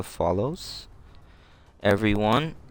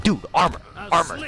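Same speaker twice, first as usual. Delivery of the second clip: higher pitched urgent warning; urgent call; 8 semitones higher than usual. Retold or imitated. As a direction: call the danger out high. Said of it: "I'm just like".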